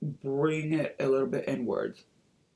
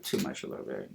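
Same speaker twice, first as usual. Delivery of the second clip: whispered aside; 11 dB quieter, faster than usual. Real, not acted. Whispered aside after mistake.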